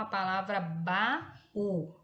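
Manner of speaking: "baú" drawn out very slowly, vowels apart; slow word demo. Shown as a demonstration. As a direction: stretch "baú" so each vowel is heard. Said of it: "Quando a gente for separar". ("baú" bem devagar, vogais separadas)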